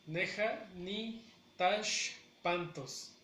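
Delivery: slow, broken into separate parts with pauses; teacher's slow model of a phrase; syllable by syllable. Shown as a demonstration. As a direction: spell out "Neja ni Tashpantos" in slow chunks. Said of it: "la pronunciación de manera natural".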